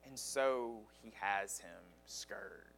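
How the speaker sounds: quiet, unvoiced and heavy; grim whisper. Real, not acted. Preacher telling the church of the scourging, whispered. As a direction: let it fall to a breath.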